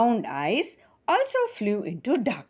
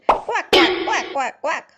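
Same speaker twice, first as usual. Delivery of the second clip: high loud quacking; duck quack; 8 semitones higher, 6 dB louder than usual. Pretend. Storyteller imitates duckling voices, high quacking. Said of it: "Their voices also sounded different".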